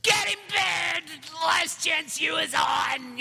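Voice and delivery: high, very loud, drawn-out exasperated yell; inner scream. Retold or imitated. Dad screaming inside to get to his show, slow frantic mock bellow. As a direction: roar it slowly, comically desperate. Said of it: "on the inside, that's what I'm doing".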